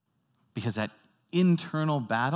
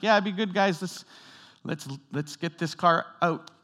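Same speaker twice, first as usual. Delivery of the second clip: pitched-up, hurried urging to the crew; urgent urging; 6 semitones higher than usual. Retold or imitated. Rescuer at a sinking car urging the crew, high and urgent. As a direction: push them to move the car now.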